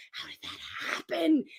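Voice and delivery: soft, mock-mystified aside; hushed wonder. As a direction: drop to a hush of feigned wonder. Mock mystery about sudden pregnancy.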